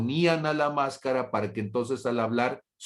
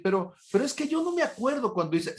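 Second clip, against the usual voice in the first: higher, fast, whiny protest; mock objecting listener; 12 semitones higher, faster than usual. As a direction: voice a student objecting he doesn't remember. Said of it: "Y me vas a decir". (oyente que protesta con voz aguda y rápida)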